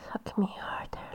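ordinary talk

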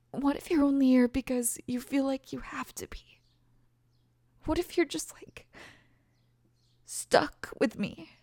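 hushed, unvoiced inner fear; whisper. Pregnant girlfriend whispering her fear to the father. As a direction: breathe the doubt out like a secret. Quoting her own night worry.

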